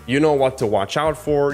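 usual speaking voice

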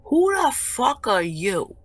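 angry challenge. Real, not acted. Streamer confronting absent ex over a death prediction, indignant. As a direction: hit each word in outrage.

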